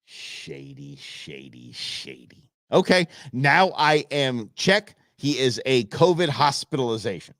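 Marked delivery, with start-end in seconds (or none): 0.1-2.5 soft low muttering; muttered aside. Real, not acted. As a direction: mutter it under your breath.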